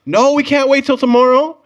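high, loud, slow urgent protest; desperate protest. Retold or imitated. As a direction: plead like you're stranded out of gas. Acted pleading with attendant.

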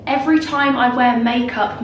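ordinary talk

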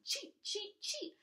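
very high angry bird chatter; chattering sparrows. Acted. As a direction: chatter like scolding birds. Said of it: "they chattered at Peony".

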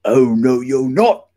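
loud troll refusal; forceful, blocking troll voice; shouting troll. A narrator's reading. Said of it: "shouted the troll".

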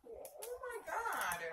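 high, drawn-out squeal; real high-pitched cooing reaction; gushing. Real, not acted. Reacting to baby gifts.

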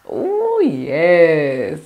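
ordinary talk

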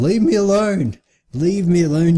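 high-pitched pleading shout; frantic, whiny outburst; distressed yell. Real, not acted. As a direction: yell at your attacker to back off. Yelling at the game opponent.

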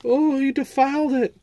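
very high, drawn-out falsetto wail; mock the scandalized critics in a squealing high voice; mock outrage. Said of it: "some guys are gonna go".

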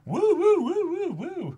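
high, loud playful woo-hoos; hammed-up fan cheering; mock excited whooping. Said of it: "that I've been like".

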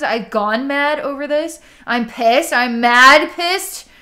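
loud, acted outburst of anger; venting, pissed off. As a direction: vent it, leaning long and hard on "mad". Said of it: "the word mad is the perfect word to describe what you're venting".

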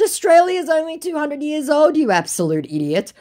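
high, loud incredulous correction; exasperated scolding. Retold or imitated. Woman correcting the scammer's history, exasperated. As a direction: scold a fool in mock outrage.

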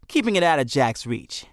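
ordinary delivery, nothing marked

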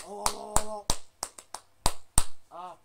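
loud mock wail of oh. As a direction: wail the ohs loudly like an upset fan. Fan toy wailing upset.

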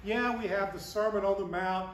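ordinary talk, nothing marked

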